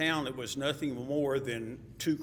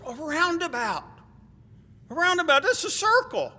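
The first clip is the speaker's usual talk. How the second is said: high, loud dawning exclamation; retold exclamation; 10 dB louder than usual. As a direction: exclaim it loudly, imitating a friend catching on. Retold friend exclaims on grasping it.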